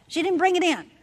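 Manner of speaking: ordinary delivery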